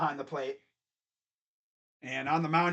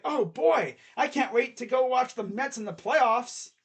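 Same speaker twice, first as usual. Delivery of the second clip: eager patient; 6 semitones higher, 3 dB louder than usual. Acted. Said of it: "And then it's like".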